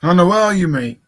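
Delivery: loud call across the room; calling out loudly. Real, not acted. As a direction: shout for a friend who has gone missing. Calling for missing streamer.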